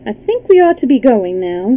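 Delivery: plain reading voice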